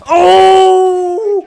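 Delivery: long, high, very loud "oh!"; excited scream. Real, not acted. Player screaming at landing an unexpected kill, excited. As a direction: draw out a piercing shout of triumph.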